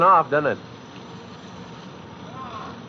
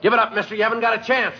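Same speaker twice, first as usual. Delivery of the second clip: calling out to gunman; faster than usual. Acted. Cop calls up to gunman.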